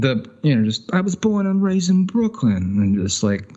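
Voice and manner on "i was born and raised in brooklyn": high-pitched mock New York accent; Joe Pesci wannabe. Mocking New Yorkers who ape Joe Pesci, New York wiseguy accent. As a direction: imitate a New Yorker playing the movie wiseguy. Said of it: "wanna be Joe Pesci so bad".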